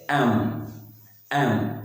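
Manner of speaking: एम ending on a closed m hum; एम with no 'ma' at the end; no full MA. Shown as a demonstration. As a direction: close the lips on एम without releasing a vowel. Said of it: "माँ पूरा sound नहीं होना चाहिए".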